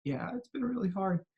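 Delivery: softly imitating a child letting go; sigh, then give in quietly; deflated. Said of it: "watch his body deflate".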